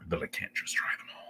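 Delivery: quick whispered aside; fast whispered regret. Real, not acted. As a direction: whisper it, a little rueful. Wistful aside.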